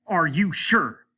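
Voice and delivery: slow, loud pressing repeat; desperate slow demand. A narrator's reading. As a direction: drag each word out, shaking him. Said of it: "shaking violently".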